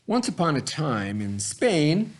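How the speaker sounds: put-on lively picture-book narration for young listeners; reading to children. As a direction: tell it like bedtime for a class of kids. Reads picture book to kids.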